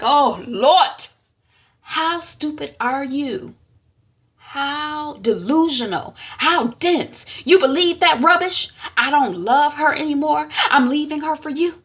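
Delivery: mock her gullibility in acted disgust; exasperated scorn. Sister berates and mocks sister.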